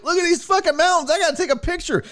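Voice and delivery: hammed-up awe at the mountains; mock excitement. Mocking excited tourist self.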